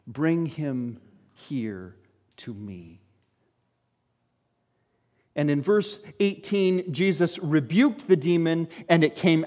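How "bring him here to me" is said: quiet and slow. Acted. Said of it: "And Jesus prays that prayer".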